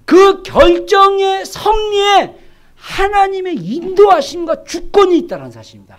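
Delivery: loud, high, deliberate proclamation; preaching at full force. Real, not acted. Preacher telling the congregation of God's guidance, full force. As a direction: drive each phrase home, slow and loud.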